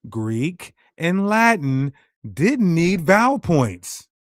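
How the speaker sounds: slow, deliberate, spelled out; said slowly. Shown as a demonstration. To the doubting audience, slowly and deliberately. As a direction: drag it out so nobody misses it. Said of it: "I'm gonna say this slowly".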